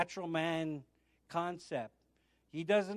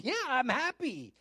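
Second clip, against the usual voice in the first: upbeat confirmation; 7 semitones higher than usual. Retold or imitated. Acting his own emphatic answer.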